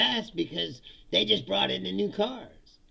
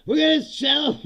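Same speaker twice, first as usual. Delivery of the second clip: loud, raised mock pep-rally voice; hyped company president; 9 semitones higher, 9 dB louder than usual. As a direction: fire up the sales force. Mimicking company president's stage hype.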